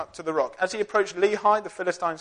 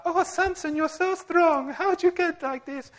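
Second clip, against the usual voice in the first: high, put-on girly voice, the last bit slower; mock Delilah voice; 13 semitones higher, slower than usual.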